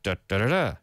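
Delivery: low "Da, da, da, da", weight on the last beat; affirmative rhythm. Shown as a demonstration. Teacher repeats the pattern, affirmative rhythm. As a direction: save the weight for the final "da".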